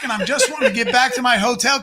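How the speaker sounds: ordinary talk, nothing marked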